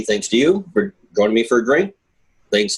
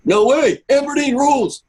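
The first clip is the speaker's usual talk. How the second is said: mock Scottish shout; 3 dB louder than usual. Mock Scottish fan protest.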